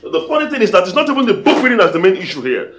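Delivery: heated accusation. Acted. Husband to wife who sold him out, angry reproach. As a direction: drive the grievance home loudly.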